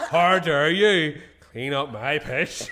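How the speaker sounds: slow, loud, drawn out in mock outrage; mock indignation. Repeating his drunk self's outrage to the hosts, slowly and loudly. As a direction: drag out the drunk's indignant words. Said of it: "She goes to me".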